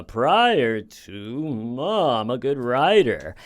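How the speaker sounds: smug, self-satisfied mock writer voice; lightly voiced snooty writer, sneering at her; mocking impression. Mocking Sarah's fancy wording, in a smug put-on voice.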